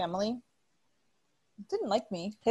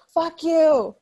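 higher, louder yelled fuck you; retold angry shout; 8 semitones higher, 8 dB louder than usual. Yelling at her jealous ex-husband before punching him, angry shout. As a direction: snap it at him like the punch is coming.

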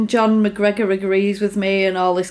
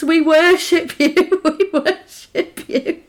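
slow, higher, sarcastic fawning, breaking into a laugh; fake-bow to the "queen of insects"; mock worship; 7 semitones higher, slower than usual. Bullies mockingly worship Jennifer, slow and laughing. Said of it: "And then they're like".

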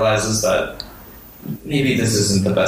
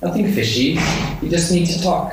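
very high squeaky gnome voice; high gnome pleading earnestly; 13 semitones higher than usual. Pretend. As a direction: pitch way up into a tiny eager gnome.

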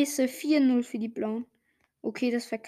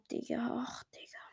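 breathy resigned whisper; 12 dB quieter than usual. Real, not acted. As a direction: sigh it out under your breath. Resigned muttering.

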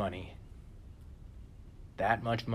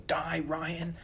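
mock yell; 7 semitones higher than usual. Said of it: "I would yell".

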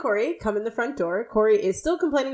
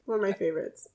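ordinary talk

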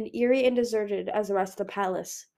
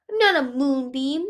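a lightly voiced young boy, higher; a small boy's protest; child protesting; 5 semitones higher, 6 dB louder than usual. A narrator's reading. Son protesting to his mother, lightly voiced. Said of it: "he protested".

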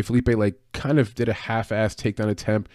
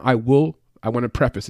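usual speaking voice

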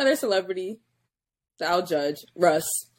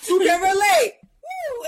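imitated yell; 10 semitones higher, slower than usual. Friend recalls a rapper's song, imitating his yelled hook. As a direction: yell the hook, then whoop high. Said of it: "he literally yelled out".

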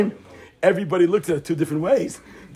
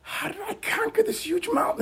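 high, softer retold lament; mock tearful wonder; 12 semitones higher, 7 dB quieter than usual. Righteous man seeing the mountain he conquered, mock tearful. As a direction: ask it in hushed, high disbelief, as if tearful. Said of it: "And he starts crying".

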